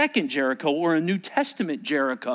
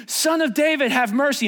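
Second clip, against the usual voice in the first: high, persistent call; crying out; 8 semitones higher, 4 dB louder than usual. Acted. Blind man continues his plea to Jesus, crying out. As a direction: keep calling, high and urgent. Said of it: "and he continued".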